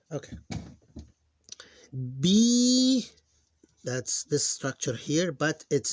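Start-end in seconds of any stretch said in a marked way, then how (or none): 2.2-3.1 B held long, much higher and louder; teacher stretching the long vowel. Shown as a demonstration. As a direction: sing out a long B. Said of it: "that's a long sound".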